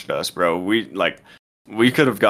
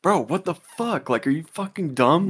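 retold yelling; 7 semitones higher than usual. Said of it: "I started yelling at him too".

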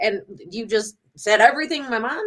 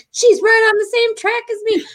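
high mock voice of her mom, much higher; mom's voice; 12 semitones higher, 4 dB louder than usual. Daughter mimics her mom agreeing, high mock voice. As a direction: raise the voice high to play mom. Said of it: "and she's like".

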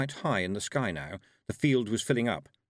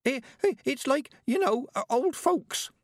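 put-on high, nervous stammering boy; frightened mumble; 3 dB louder than usual. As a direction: squeak it out in terror. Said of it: "the boy mumbled".

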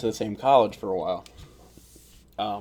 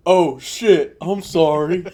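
imitated startled apology; 6 semitones higher, 8 dB louder, much slower than usual. Retells friend's startled apology.

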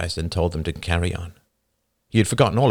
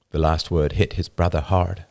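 ordinary delivery

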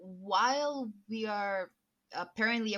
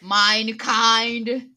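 loud, drawn-out vow, weighted word by word; solemn declamation; 9 dB louder, slower than usual. Pretend. Penitent speaking to Father, loud mock-solemn declamation. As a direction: proclaim it like a grand oath. Grand confession spoken in character.

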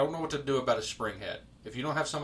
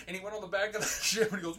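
normal talking voice

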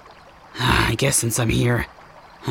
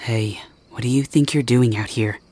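hushed whisper. Acted. Guard catches listener sneaking out.

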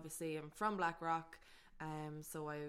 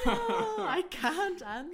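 laughing squeal; 12 semitones higher, 11 dB louder, much slower than usual. Real, not acted. Put on the spot, squealing.

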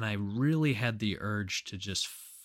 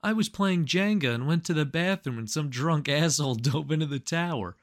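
put-upon Jenga player; 6 semitones higher, 5 dB louder than usual. Pretend. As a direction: complain about the tower like a fussy sitcom character.

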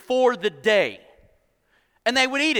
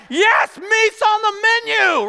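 high, loud, drawn-out celebration; crowd's cheer; 12 semitones higher, 7 dB louder, slower than usual. Acted. Everybody hears they will eat meat, excited shout. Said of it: "like, everybody be like".

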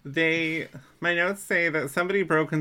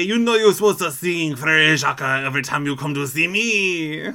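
loud, sing-song mock French accent; hammed-up French accent; 3 semitones higher, 8 dB louder than usual. Dad's bit about singing Frère Jacques, hammed-up French accent. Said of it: "let us know how that accent was".

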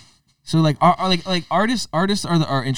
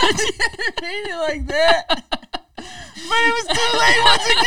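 high, cracking laugh-cry words; laughing through words; 5 dB louder than usual. Real, not acted. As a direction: squeeze it out while laughing. Laughing apologizing.

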